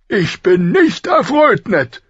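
slow, high, loud displeasure; heavy royal disapproval; displeased king. A narrator's reading. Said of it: "brummte Robert".

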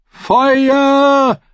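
long high shout from a tower; guard's shout. Acted. Guard on the tower shouts a warning, loud and high. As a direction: call Feuer! down from the wall, high and loud. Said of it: "Rief eine Wache vom Turm herunter".

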